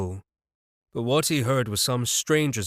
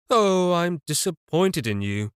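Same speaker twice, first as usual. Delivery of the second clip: higher, slow, mocking sigh; mock disappointment; 6 semitones higher than usual. A narrator's reading. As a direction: taunt him, feigning disappointment. Stranger mocking the boss.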